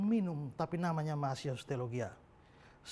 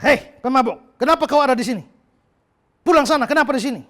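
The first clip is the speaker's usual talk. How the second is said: loud, raised voice chasing someone off; angry shout; 6 semitones higher, 17 dB louder than usual. Retold or imitated. Pastor yelling at a drunk on the church steps, furious shout. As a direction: bark him out of the doorway, loud and higher. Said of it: "Lalu pendeta marah".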